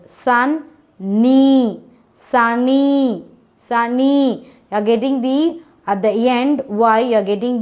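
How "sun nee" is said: "Sun, knee" broken into two separate beats; split into syllables. Shown as a demonstration. Teacher breaking the word for children, syllable by syllable. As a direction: chop "sunny" into "Sun" and "knee". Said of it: "divide it like this".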